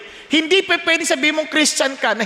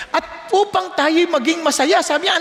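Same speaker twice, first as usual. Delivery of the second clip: ordinary talk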